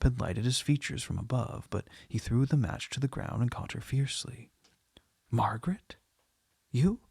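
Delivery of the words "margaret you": a mildly hoarse cry of recognition. A narrator's reading. Man recognising his lost love, crying out hoarsely. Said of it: "he cried hoarsely".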